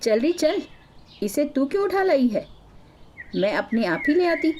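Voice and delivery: high-pitched, irritated snub from a neighbour; mild narrated shouting. A narrator's reading. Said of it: "वो औरत चिल्लाकर बोली". (ऊँचे सुर में झिड़कना, हल्का चिल्लाकर)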